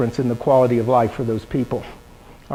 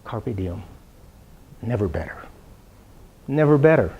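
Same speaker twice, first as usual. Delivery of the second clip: quiet mantra; 7 semitones lower, 8 dB quieter than usual. Retold or imitated. Self-affirmation mantra.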